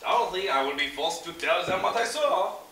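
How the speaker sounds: a con man playing a Frenchman, French accent throughout; fake French accent. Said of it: "That doesn't sound very French, Pierre".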